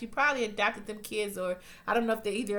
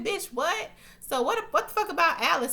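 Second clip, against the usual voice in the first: high-pitched disbelief; incredulous outburst; 8 semitones higher than usual. Retold or imitated. Viewer reacting on behalf of left-out Alice, incredulous. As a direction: react as if you can't believe them. Teller's shocked reaction to the show.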